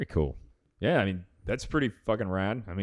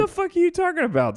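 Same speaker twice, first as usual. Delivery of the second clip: incredulous outburst; faster than usual. Real, not acted. Mock outrage at thin remark.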